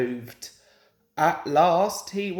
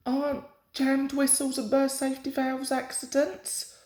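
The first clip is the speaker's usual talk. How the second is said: higher, innocent little engine; innocently; 6 semitones higher than usual. A narrator's reading. Percy teasing Gordon, lightly innocent. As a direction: ask sweetly, all innocence. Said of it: "asked Percy innocently".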